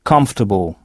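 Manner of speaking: "Comfortable," said KUMF-tuh-bl, stress on the first syllable; English pronunciation. Shown as a demonstration. Teacher shows the English word to French learners. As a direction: say "Comfortable," with the O and R nearly silent. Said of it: "la prononciation est très différente".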